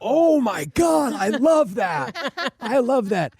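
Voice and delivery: high, slow, gushing and loud; delighted exclamation. Retold or imitated. Lawyer reacting to a colleague's jukebox line, slow delighted gush. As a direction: savour every word like you just heard genius. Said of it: "And I was like".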